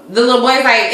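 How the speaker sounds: ordinary delivery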